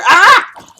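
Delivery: loud high shriek of fright; real startled scream. Real, not acted. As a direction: shriek as if jumped at. Startled by mob in game.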